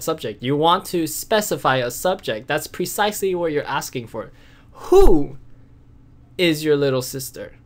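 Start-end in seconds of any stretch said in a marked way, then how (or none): 4.9-5.4 "Who" punched high and loud; stress on WHO. Shown as a demonstration. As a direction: hit "Who" hard and pause. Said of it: "Who is the important part?".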